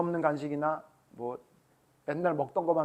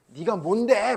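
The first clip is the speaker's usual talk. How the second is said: raised, louder challenge; mock defiance; 5 semitones higher, 7 dB louder, slower than usual. Unruly kids challenge their appointed leader, mock-defiant. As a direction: talk back, pitched up and louder. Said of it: "막 이러고 막 대들어".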